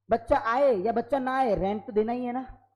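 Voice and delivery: ordinary delivery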